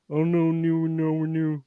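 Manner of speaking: imitating deaf-accented speech; Miles Bourne. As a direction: mimic how a deaf speaker sounds. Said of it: "he sounds like he's, like, deaf".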